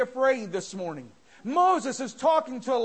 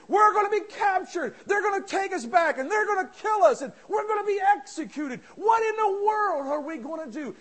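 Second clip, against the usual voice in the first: higher, louder, frantic shout; panicked servant; 5 semitones higher, 4 dB louder than usual. Acted. Servant panics to Elisha, high frantic shout. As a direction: spill out fear of capture.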